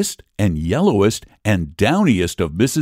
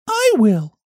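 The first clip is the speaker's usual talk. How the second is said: much higher hen voice, resigned; little red hen; 14 semitones higher than usual. Acted. Hen answering the lazy animals, high resigned voice. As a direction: shrug and take it on yourself. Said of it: "said the little red hen".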